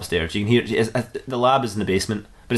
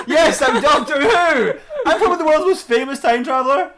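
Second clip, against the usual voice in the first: high, loud, laughing boast; exclaiming proudly; 4 dB louder than usual. Acted. The Doctor tells Dexter he is the famous time traveller, exclaiming.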